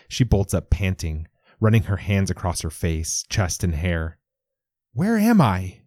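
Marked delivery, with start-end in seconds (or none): 4.9-5.8 panicked high shout. A narrator's reading. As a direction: shout it, disoriented. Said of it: "she shouts at Lewis".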